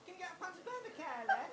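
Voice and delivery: high, soft animal-like call; plaintive cry. Acted. Friend calling to Lynn like a creature in the wild, plaintive cry. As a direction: call it out small and high-pitched. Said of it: "her familiar plaintive cry".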